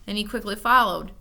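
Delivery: plain reading voice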